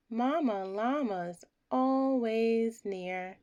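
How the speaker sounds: plain reading voice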